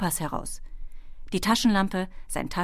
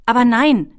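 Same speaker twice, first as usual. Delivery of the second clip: very loud, higher contradiction; calling out; 6 semitones higher, 13 dB louder than usual. A narrator's reading. Ben contradicts the kobold about the map, calling out. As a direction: cry out to correct a mistake. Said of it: "rief Ben".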